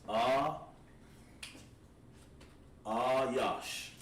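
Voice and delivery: Ah held apart, then Ayash said whole; syllable drill. Shown as a demonstration. Teacher drilling students on Ayash. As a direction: break Ayash into Ah and Yash. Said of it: "So you say Ah, Yash".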